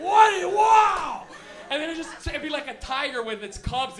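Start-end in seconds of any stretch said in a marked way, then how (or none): 0.0-1.3 high, loud amazed cry. Retold or imitated. Kid watching the show crying out in loud amazement. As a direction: gasp out in awe at the screen. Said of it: "you're like".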